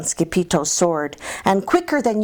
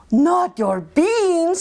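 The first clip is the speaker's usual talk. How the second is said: outraged; 10 semitones higher, much slower than usual. A narrator's reading.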